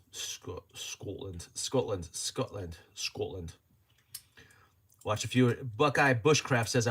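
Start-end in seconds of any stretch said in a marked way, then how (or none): 0.1-3.5 low, soft Scottish accent; practised Scottish accent. Shown as a demonstration. Streamer rehearsing the country's name to himself, low Scottish accent. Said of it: "practicing my Scottish accent".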